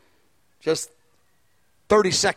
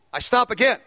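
usual speaking voice

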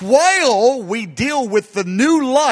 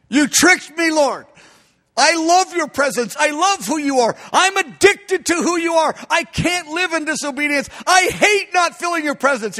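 higher, impassioned declaration of love; fervent confession; 6 semitones higher than usual. Acted. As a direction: confess addiction to God with fire. Believers cry like Jeremiah.

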